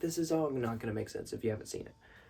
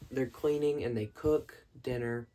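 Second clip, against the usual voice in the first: ordinary talk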